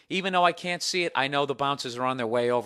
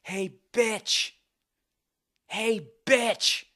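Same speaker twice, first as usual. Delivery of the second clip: higher mock yell of an angry woman; imitating woman yelling; 8 semitones higher, much slower than usual. Imitating the woman at the bar, a mild mock yell. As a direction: call it across the bar, a hint of her shrieking. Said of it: "this woman yelling".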